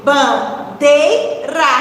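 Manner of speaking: "Bandeira" very slow, in three separate beats; syllable by syllable. Shown as a demonstration. Teacher finding the middle syllable, "Bandeira" slowly split into syllables. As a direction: break "Bandeira" into ban... dei... ra. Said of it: "A sílaba medial é a sílaba do meio".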